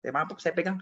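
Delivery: ordinary delivery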